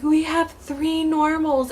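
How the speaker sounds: high, slow, choked-up news; retold sobbing announcement; tearful announcement. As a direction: say it through tears, pitched high. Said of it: "And I was like".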